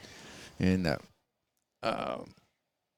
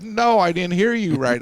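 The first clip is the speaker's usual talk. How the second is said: mock disbelief; 9 semitones higher, 5 dB louder than usual. Judge refuses the call.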